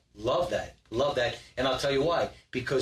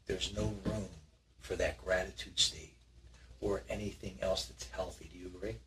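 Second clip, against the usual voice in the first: hushed; 3 semitones lower, 9 dB quieter than usual. Acted. Host builds a hushed, dramatic mood.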